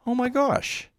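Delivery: high surprised oh my gosh; a sudden discovery; amazed realization. Retold or imitated.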